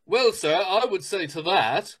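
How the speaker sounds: a posh-ish British gentleman arguing politely; playfully imitating a civil British debater; mock British argument. Friend mocks polite British arguers, lightly imitating British accent. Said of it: "They'll be like".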